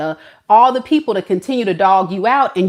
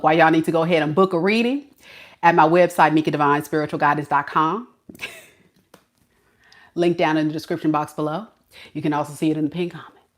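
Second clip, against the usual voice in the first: rushed ad plug; 4 semitones lower, much faster than usual. Shown as a demonstration. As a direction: rattle off the plug like a fast ad disclaimer. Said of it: "Nah, I'm just playing".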